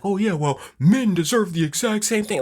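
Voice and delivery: high, nasal-free mock whine in another voice; mocking whiner. Host parodies men online demanding equal treatment, high mocking voice. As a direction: parody the complainer in a put-on high voice. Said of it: "to sit there and be like".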